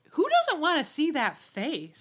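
high, loud mock disbelief; mock outrage. Fan pretending to be outraged at Roger. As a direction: play up the shock of it. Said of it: "And you're like".

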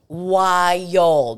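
"Wild" stretched long and loud; "Wild" said slowly, loud and drawling; drawn-out emphasis. Real, not acted. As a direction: stretch "Wild" into two syllables. Echoes word, drawn out for emphasis.

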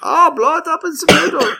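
high put-on German voice; hammed-up German accent; mock German. Mocking what Germans say, in a silly German accent. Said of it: "that's what Germans say".

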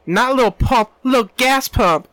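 high, loud laughing correction; high giddy correction of a silly name; excited correction. Real, not acted. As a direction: blurt out the right name, delighted. Correcting the rapper name loudly.

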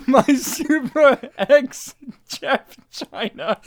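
giggling through the name; laughing. Real, not acted. Giggling over silly phrase.

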